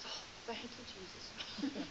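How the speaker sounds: breathy whisper as the relieved dog; very soft, low mock whisper; whispered relief. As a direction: whisper it like a grateful prayer. Voicing the relieved dog's thought.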